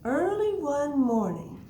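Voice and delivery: high, loud, drawn-out story opening; picture-book reading. Acted. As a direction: stretch the words out for small children. Reads picture book aloud.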